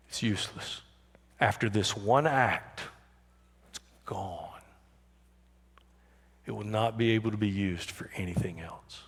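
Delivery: dropped to a whisper; hushed. Real, not acted. Preacher hushing the church over the broken jar, whispered. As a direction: let it fall to a near whisper.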